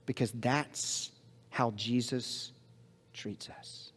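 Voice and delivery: slow, fading into a hushed whisper. Real, not acted. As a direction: let the last words fall to a breath. Sermon climax trails to whisper.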